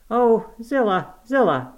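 high, loud, drawn-out wail of dismay; distraught cry. A narrator's reading. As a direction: cry her name in anguish. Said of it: "I gasped".

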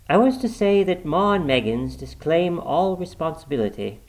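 measured, official visitor's voice; formal messenger. A narrator's reading. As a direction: deliver the firm's sympathy as a stiff official message. Visitor gives official statement.

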